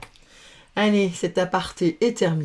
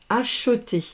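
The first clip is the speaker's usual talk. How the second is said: ordinary talk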